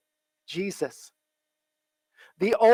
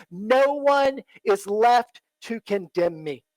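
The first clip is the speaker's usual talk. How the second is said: slow, deliberate declaration; a slow, firm affirmation; slower than usual. Shown as a demonstration. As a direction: say it slowly, word by word. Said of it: "So that she can say".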